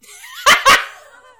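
loud high cackling shriek; shrieking laugh. Real, not acted. P tape joke.

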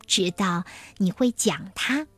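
ordinary delivery, nothing marked

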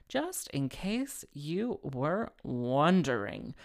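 drawn out word by word; slow, deliberate repeat. Real, not acted. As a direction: stretch each word with mock significance. Repeats aside slowly for comic emphasis.

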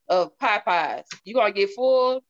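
ordinary talk

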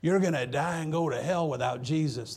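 a touch of a fiery warning to a mugger; mild retold warning. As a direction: bark it at the robber without going full out. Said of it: "this is what he said to the guy".

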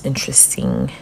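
whispered aside to herself. Real, not acted. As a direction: breathe the word in a whisper. Reading results under breath.

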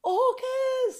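very high, loud lookout cry; retold shout. Swimmer imitating somebody spotting orcas, high loud shout. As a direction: call it out as a sudden wildlife sighting. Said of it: "somebody shouted".

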